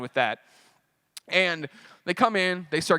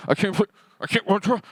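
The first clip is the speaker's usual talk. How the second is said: slurred speech; 5 semitones higher, 4 dB louder than usual. Retold or imitated. Retelling slurred speech during paralysis.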